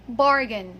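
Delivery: "bargain" as "BAR-gun", first syllable stressed; correct pronunciation. Shown as a demonstration. Teacher models the word for learners, bargain said correctly. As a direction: punch BAR in "bargain", short unstressed ending. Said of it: "one more time".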